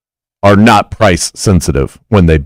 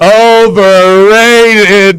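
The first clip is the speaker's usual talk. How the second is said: drawn-out overrated; 11 semitones higher, 3 dB louder, much slower than usual. Acted. Drawn-out mock verdict.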